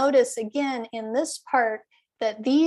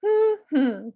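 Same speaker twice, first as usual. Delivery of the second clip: two-beat hum; 5 semitones higher than usual. Shown as a demonstration. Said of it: "say the humming".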